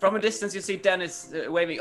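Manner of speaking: ordinary talk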